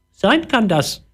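very high squawky parrot talk. Acted. Parrot answering the boy in a high squawky voice. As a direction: chirp it out like a little parrot. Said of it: "sagte er".

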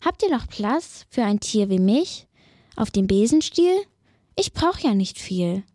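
ordinary delivery

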